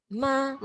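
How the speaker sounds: MA held high and perfectly level; high flat tone. Shown as a demonstration. Teacher modelling the first tone, high and flat. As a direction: sing out MA on one high, flat note. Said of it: "but I go flat".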